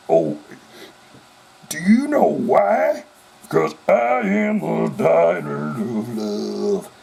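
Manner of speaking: slow, drawn-out rock-star purr. Retold or imitated. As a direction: stretch it out, hamming up the seduction. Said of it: "I'd rather do the [name]".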